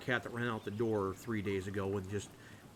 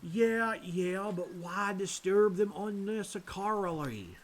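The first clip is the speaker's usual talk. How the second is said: slow, raised Georgia priest drawl; deep Georgian accent; 8 semitones higher, 5 dB louder, much slower than usual. Acted. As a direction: object in a drawn-out Georgia accent. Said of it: "the deep Georgian accent".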